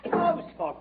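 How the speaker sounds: high, wailing apology; anguished plea. Acted. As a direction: beg forgiveness in a rush of guilt. Detective begs forgiveness in anguish.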